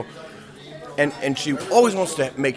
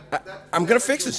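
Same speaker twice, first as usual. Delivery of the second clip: much higher, fast and determined; determined purser; 10 semitones higher, faster than usual. Retold or imitated. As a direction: rush out the resolve to fix it. Purser resolves to intervene.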